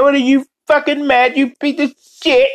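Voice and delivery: high, indignant put-on voice; Robotnik Cat sixty-nine. Streamer as the character berating a listener, high mock outrage. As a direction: scold them in a high cartoon voice. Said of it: "Robotnik Cat sixty-nine".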